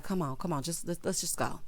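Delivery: soft, hushed urging; imitated gentle coaxing. Production nudging him out before trouble, soft coaxing. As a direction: drop the volume and coax him away.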